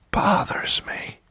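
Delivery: soft, slow, strained whisper; tense whisper. Acted. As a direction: whisper it with pent-up irritation. Confides irritation, then repeats it.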